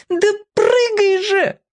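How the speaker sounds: put-on high, drawn-out girl's cry; shouting girl. A narrator's reading. Girl urging the boy to jump, shouting. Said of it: "изо всех сил крикнула белобрысая девчонка".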